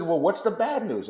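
wife's voice. Retold or imitated. Wife voiced in story.

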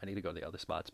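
ordinary talk, nothing marked

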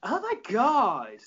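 put-on slow, rising Valley girl gush; drawn-out Valley girl. Shown as a demonstration. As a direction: stretch it like a gushing Valley girl. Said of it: "say it in a different inflection".

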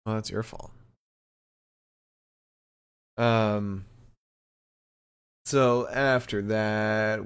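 normal talking voice

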